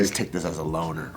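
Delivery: hushed retold dealer. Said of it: "retold dealer".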